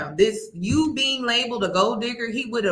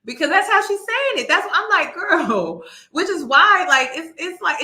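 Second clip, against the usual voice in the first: high-pitched giggly talk; laughing through the words; 7 semitones higher, 5 dB louder than usual. Real, not acted. Explaining to viewers why she laughs, giggling high. Laughing about her reading.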